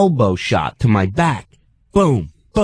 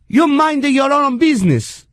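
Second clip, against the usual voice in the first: much higher, scolding foreign lady; mock Italian-sounding accent; 11 semitones higher than usual. Older woman told to stop pushing, indignant Italian-sounding accent. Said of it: "Now she's Italian".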